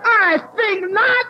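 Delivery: loud, high, drawn-out haughty refusal; indignant refusal. Retold or imitated. Woman refuses border officer's brush-off, indignant. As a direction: refuse him slowly, at full volume.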